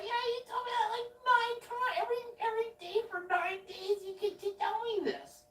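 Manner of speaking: high, exasperated whine. Retold or imitated. Him telling neighbor she repeats herself, high exasperated whine. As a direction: whine it, fed up. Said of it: "I'm like".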